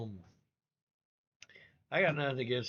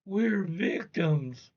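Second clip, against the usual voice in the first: slow, drawn-out mock plea, a bit louder; mock victim plea; 6 semitones higher, 6 dB louder, much slower than usual. Mocking a plea to the international audience, slowly drawn out. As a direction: play the wronged innocent, stretching the words. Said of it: "call out to the international audience and say".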